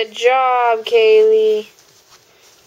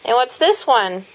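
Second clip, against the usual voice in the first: put-on reading to a toddler. Mother reading animal book to toddler.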